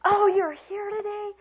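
high, loud, drawn-out delight; enthusiastic welcome. Shown as a demonstration. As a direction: gush that they've turned up. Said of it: "Change that whole thing".

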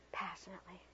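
breathy, intimate whisper; passionate whisper. Shown as a demonstration. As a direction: breathe it like a lover. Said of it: "like this".

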